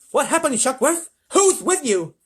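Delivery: high, loud presidential demand; urgent questioning. A narrator's reading. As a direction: fire questions in alarm.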